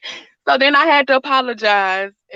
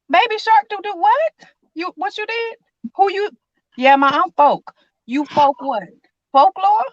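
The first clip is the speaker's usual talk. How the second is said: mock bafflement; 7 semitones higher than usual.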